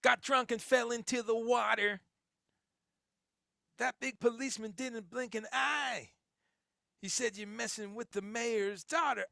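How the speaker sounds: high, loud [name] rock-singer talk. Retold or imitated. Host doing a [name] bit for the chat. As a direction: channel a swaggering rock frontman. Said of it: "I hear [name]".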